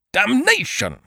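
high, loud, furious bellow; bellowed curse. A narrator's reading. Holmes shot in the arm, bellowing. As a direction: bellow it out in pain and rage. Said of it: "bellowed Holmes".

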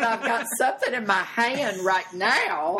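loud, high, drawn-out exasperation; indignant comeback. Retold or imitated. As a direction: belt out the indignant punchline, slowly. Outraged comeback to fan while peeing.

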